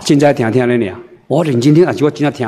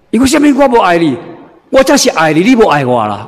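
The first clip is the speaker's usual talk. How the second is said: indignant husband; 6 semitones higher than usual. Retold or imitated. Husband retorts angrily.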